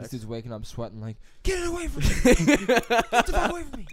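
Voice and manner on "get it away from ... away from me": much higher, louder terrified yell; mock scream. Friend waking from Nutella nightmare, mock screaming.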